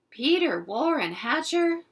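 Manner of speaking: a mom about to scold; scolding full name. Acted. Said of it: "my mother began".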